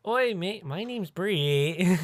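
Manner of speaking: high, slow hammed-up Australian accent; mock Australian accent. Host mocking the contestant's fake Australian accent. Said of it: "She goes straight back into her accent".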